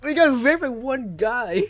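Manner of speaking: high, loud, slow and exasperated; exasperated disbelief. Real, not acted. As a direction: drag it out in disbelief. Reporting the squad wiped out.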